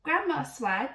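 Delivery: ordinary delivery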